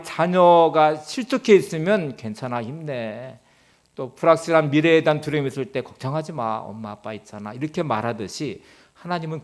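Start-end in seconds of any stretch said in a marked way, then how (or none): 2.2-3.4 slow and much softer; gentle parent. Retold or imitated. Parent comforting fallen child. 5.8-7.5 much softer reassurance; calm a worried child; gentle parent. Retold or imitated.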